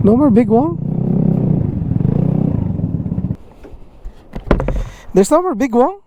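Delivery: high, surprised question. Real, not acted. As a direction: disbelief the place closed. Surprised restaurant closed.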